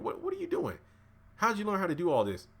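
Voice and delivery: high, incredulous questioning; high-pitched mock bewilderment; retold disbelief. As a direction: act out a startled demand for answers. Retold boss confronting aide in disbelief.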